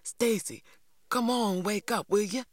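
high-pitched, pleading call at the door; secretive wake-up call, still fully voiced; soft urgent call. A narrator's reading. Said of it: "calling softly".

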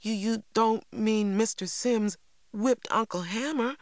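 high, stumbling disbelief; unbelieving stammer. A narrator's reading. Younger brother questions the news about uncle, stammering disbelief. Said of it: "stammered an unbelieving Christopher John".

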